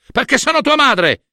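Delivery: high, outraged retort; exasperated mother's cry; indignant insistence. A narrator's reading. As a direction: throw it back at him, pitched right up. Hera insists to son.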